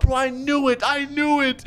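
high, excited outburst; vindicated exclamation. Real, not acted. Player learning of the faked death, excitedly vindicated. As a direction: exclaim it high, as if proven right.

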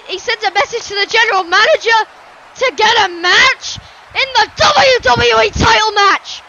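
appalled ringside call with punched words; outraged play-by-play. Acted. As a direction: protest the attack, swelling to shouts. Outraged commentary on the attack.

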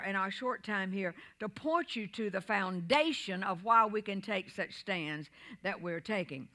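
usual speaking voice

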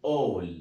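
all said as one clear open aw sound; slow careful model of all; model pronunciation. Shown as a demonstration. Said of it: "لما أجي أنطقهم كده مع بعض بيتنطقوا".

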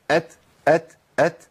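أت with voiceless air after the ت; breath-only ta. Shown as a demonstration. Teacher isolating the correct ta, ت released on breath. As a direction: end أت on a light puff, no added sound. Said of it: "مفيش صوت بيطلع".

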